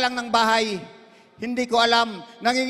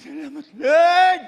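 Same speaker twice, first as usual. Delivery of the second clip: slow, louder shouted thanks; loud thanks to God; 3 semitones higher, 5 dB louder, slower than usual. Real, not acted. Worshipper crying thanks to the Lord, loud and slow.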